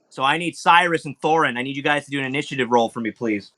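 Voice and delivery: usual speaking voice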